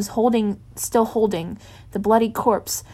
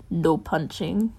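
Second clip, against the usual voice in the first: half jokingly; 3 semitones lower, slower than usual. Acted. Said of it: "half jokingly".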